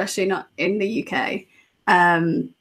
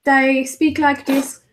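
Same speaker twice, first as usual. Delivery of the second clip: high, slow nasal Brummie; exaggerated Birmingham accent; 4 semitones higher, 4 dB louder than usual. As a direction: whine it through the nose like a Brummie. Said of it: "near a city called Birmingham".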